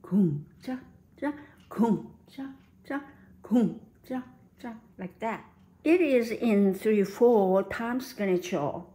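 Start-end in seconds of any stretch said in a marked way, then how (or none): 0.0-5.4 heavy KUM then two light chas; oom-pah-pah waltz count; waltz rhythm. Shown as a demonstration. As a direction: lean on kum, tap the chas. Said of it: "Waltz rhythm is like this".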